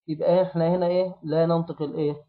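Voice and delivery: usual speaking voice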